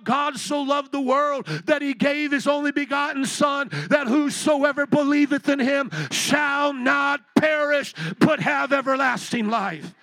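loud, building scripture proclamation; preaching. Acted. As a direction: proclaim the verse to the back row.

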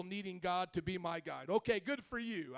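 usual speaking voice

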